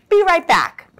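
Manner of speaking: high, quick chirpy exit line; eager. Acted. Sheep to Sheepdog, eager and high. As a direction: bounce off stage cheerfully.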